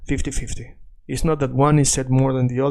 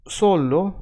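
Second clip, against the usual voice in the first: solo with a higher, stressed "so"; stress on first syllable; 5 semitones higher than usual. Shown as a demonstration. Teacher showing where the accent falls, solo stressed on "so". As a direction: lean on "so" in solo, keep the final o. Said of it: "the accent in so".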